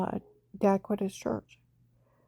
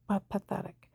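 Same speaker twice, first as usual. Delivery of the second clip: whispered scorn; 3 semitones lower, 8 dB quieter than usual. Retold or imitated. Ending the husband's scorn.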